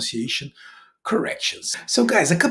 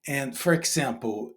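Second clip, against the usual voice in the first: ordinary talk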